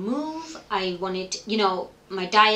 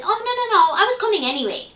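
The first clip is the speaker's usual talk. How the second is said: much higher, bright mock friend's voice; imitating her friend; 11 semitones higher, 6 dB louder, faster than usual. Friend on the phone hearing her cry, in a high imitating voice. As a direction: squeak it out like the friend covering.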